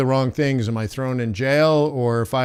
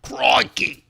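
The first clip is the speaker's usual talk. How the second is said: whispered Australian "Crikey"; a breathy Aussie aside; hushed hammed-up Australian exclamation; mock Australian crikey; 10 semitones higher than usual. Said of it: "I have to fake an accent".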